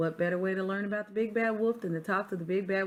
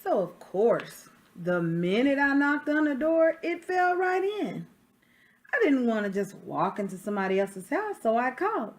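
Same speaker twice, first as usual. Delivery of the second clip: lively read-aloud as the wolf, a touch louder; story narration; 5 semitones higher, 7 dB louder than usual. Acted. Reading a picture book to children, storyteller voice. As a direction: tell it like the wolf defending himself.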